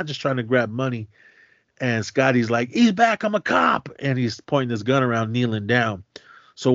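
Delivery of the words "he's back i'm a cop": urgent call. Retold or imitated. Scotty revealing himself mid-deal.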